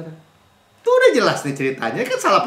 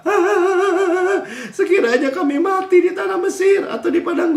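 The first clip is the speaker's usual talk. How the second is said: whining grumble; 10 semitones higher than usual. Acted. Israelites wailing at Moses and Aaron, hammed-up whine. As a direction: ham up a crowd moaning they'd rather die. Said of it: "bersungut-sungut kan".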